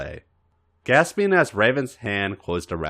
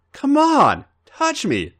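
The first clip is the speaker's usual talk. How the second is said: demanding gasp; 5 dB louder, slower than usual. Acted. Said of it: "he demanded in a gasp".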